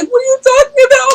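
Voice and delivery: high-pitched imitation of crying while asking; tearful protest. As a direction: whine it out as if bursting into tears. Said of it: "I start crying 'cause I'm very emotional".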